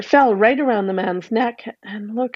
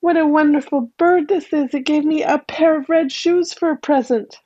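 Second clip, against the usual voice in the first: put-on higher, delighted child voice; joyful girl; 7 semitones higher than usual. A narrator's reading. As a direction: bubble over with delight. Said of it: "and said".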